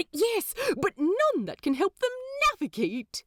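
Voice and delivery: a prim angel, caught out and flustered; Aziraphale voice. A narrator's reading. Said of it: "Aziraphale sighs, long suffering".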